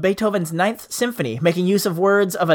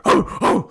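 bark at oneself. Real, not acted. Host annoyed at his own flubs, barking at himself. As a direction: bark out a wordless huff. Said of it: "I bark at myself".

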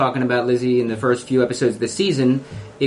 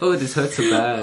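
mock pain. Mocking fake pain.

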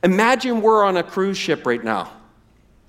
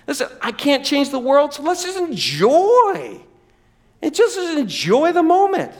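carefree hedonist; 7 semitones higher than usual. Acted. Hedonist student telling the philosopher she'll just enjoy, dreamy and high. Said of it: "here's what she says".

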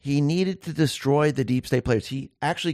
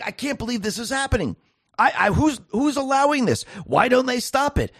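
imitating angry disbelief; 10 semitones higher than usual. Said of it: "you getting really angry and saying".

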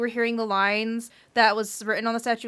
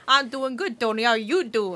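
mock New Yorker; 3 dB quieter than usual. Tony voice joke.

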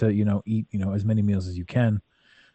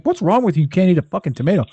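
higher, faster mock outrage at a friend; mock incredulous; 7 semitones higher, 3 dB louder, faster than usual. Mocking people who can't eat tomatoes, fast incredulous jab. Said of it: "I'm like".

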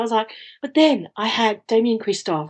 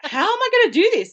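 panicked; 13 semitones higher than usual. Retold or imitated. Retold panic mid-walk.

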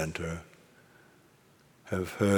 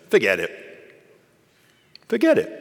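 loud, sharp raven outburst; curt raven master; 5 semitones higher, 8 dB louder, much faster than usual. Acted. Raven dismissing Badger's question, curt squawk. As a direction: squawk it out abruptly like a caustic raven. Voices Raven's snapped command.

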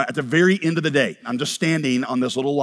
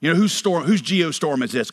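usual speaking voice

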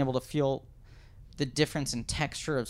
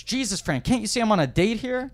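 higher, louder mock exasperation; mock indignant outburst; 9 semitones higher, 5 dB louder than usual. [name] snapping at [name] at his date table, mock irritated. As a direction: snap at him as if interrupted.

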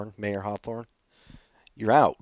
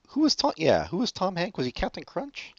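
high confused questioning; 9 semitones higher than usual. Real, not acted.

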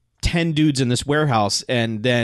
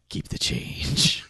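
low, slow, breathy Australian one-liner; Australian accent; 10 semitones lower, 6 dB quieter, much slower than usual. Retold or imitated. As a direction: growl it like an Australian action hero. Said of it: "In his Australian accent".

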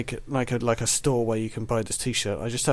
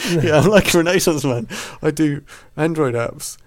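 loud, higher, slow self-important brag; hammed-up pride, big and slow; mock grand boast; 4 semitones higher, 8 dB louder than usual. Playful boast after compliment.

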